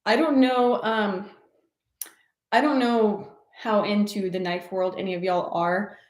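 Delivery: ordinary talk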